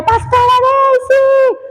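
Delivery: high, slow called-out name; calling out from afar. Retold or imitated. Pastor spots her in a crowd and calls out, high and drawn out. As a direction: call her name across a big hall. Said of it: "alguien grita por allá".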